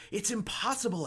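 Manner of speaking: high, shocked outburst; stunned disbelief. A narrator's reading. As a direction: gasp it out in disbelief. Boy stunned at the monster.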